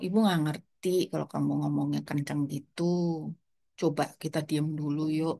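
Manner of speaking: put-on gently de-escalate an upset teenager; retold patient parent, hushed and unhurried; speaking softly. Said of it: "saya justru ngomongnya pelan".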